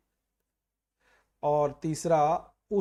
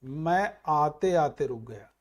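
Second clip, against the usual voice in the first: slow, careful मैं आते आते रुक गया; teacher modelling the right आते आते slowly; correct form; 2 semitones lower, slower than usual. Shown as a demonstration. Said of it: "तो सही क्या हो गया".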